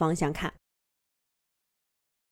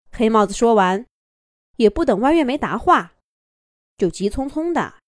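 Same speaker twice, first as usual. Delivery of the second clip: ordinary delivery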